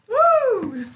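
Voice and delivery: high, loud cheer of joy; excited whoop. Real, not acted. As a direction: let out a big happy woo. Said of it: "I'm so excited".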